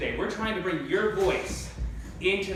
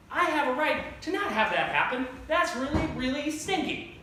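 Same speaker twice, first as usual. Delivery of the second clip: higher-pitched mild protest; mock complaint; 8 semitones higher than usual. Colonists object to the king, mock whiny complaint. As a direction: lightly voice an aggrieved colonist, pitched up.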